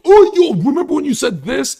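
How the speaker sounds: very high, shouted fan impression; hammed-up excited outburst; overexcited fan. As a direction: yell it like a hyped-up fan. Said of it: "you're so excited to talk to me".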